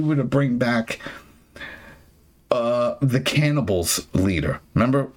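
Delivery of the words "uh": ordinary delivery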